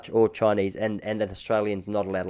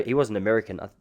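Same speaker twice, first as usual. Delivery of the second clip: ordinary delivery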